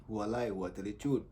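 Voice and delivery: normal talking voice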